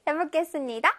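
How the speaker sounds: ordinary talk, nothing marked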